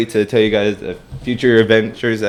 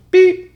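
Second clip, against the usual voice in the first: very high electronic beep; stud finder beep; 8 dB louder than usual. Retold or imitated. Imitating stud finder beeping.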